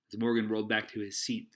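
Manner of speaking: usual speaking voice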